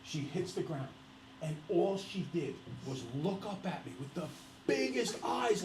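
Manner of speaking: ordinary delivery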